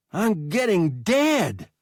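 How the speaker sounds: a slow, high whine flung back; high whining boy. A narrator's reading. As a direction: drag it out like a hurt kid.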